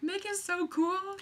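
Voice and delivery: put-on like a fourteen-year-old meeting her idol; teen fangirl gush. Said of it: "I was like".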